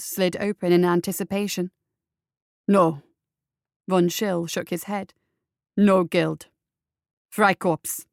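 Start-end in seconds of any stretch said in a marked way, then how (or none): 2.7-3.0 low, louder firm no; flat refusal from a tough man; gruff reply. A narrator's reading. Von Schill answers the machine. 5.8-8.1 louder, firm tough-guy voice; gruff mercenary. A narrator's reading. Von Schill answers the machine.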